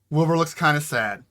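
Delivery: usual speaking voice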